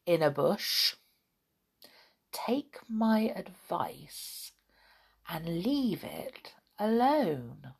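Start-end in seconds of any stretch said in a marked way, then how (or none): 2.3-7.7 slow hushed warning whisper. A narrator's reading. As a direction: lean in and whisper the secret. Storyteller's secret warning to children.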